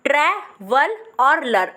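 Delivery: ट्रैवल और लर, slowly in two chunks; slow split syllables. Shown as a demonstration. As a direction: spell the sound out slowly. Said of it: "सबसे पहले बोलो". (धीरे धीरे टुकड़ों में)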